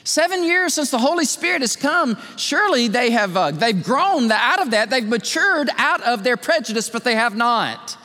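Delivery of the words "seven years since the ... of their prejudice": imagined listener. Retold or imitated. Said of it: "you say".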